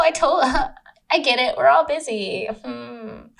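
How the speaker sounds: high, breezy fake acceptance; feigned understanding. Woman pretending she understands he's busy, fake breezy.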